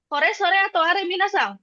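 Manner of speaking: lightly voiced teacher quizzing students; teacher asking class. Shown as a demonstration. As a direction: quiz the class brightly. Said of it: "sensei ngomongnya". (guru bertanya ke murid)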